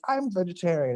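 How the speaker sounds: high, eager little boast; proud reply. Retold or imitated. Teen tells the band guy he's vegetarian, high and eager. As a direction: say it proudly, almost squeaking.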